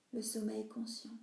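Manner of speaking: ordinary delivery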